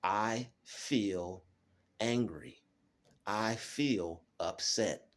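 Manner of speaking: slow, deliberate, naming each feeling; measured self-talk, unhurried; slow calm self-talk. Shown as a demonstration. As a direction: calmly spell out the feeling to yourself. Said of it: "You have to say to yourself".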